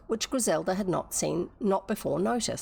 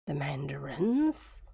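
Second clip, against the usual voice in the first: whispered disbelief; 5 semitones lower, slower than usual. Acted. Griselda repeats in disbelief.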